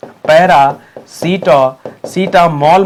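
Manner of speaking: "पैरासिटा-, सिटामोल" in slow, separate pieces; drawn-out syllables with a gap inside "paracetamol"; slow breakdown. Shown as a demonstration. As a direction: break "paracetamol" apart, holding each piece. Teacher breaks up confusable word.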